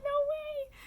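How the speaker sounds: very high, loud squeal; thrilled disbelief. Retold or imitated. Trader hearing the lost card arrived, thrilled disbelief. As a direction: squeal it in amazed delight.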